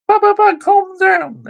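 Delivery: high, whiny mock voice of a defending fan. Ranting fan mimics rival fans' excuses, whiny mock voice. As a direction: sneer it like a whining apologist.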